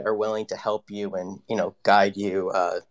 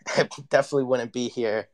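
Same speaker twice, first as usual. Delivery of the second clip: ordinary delivery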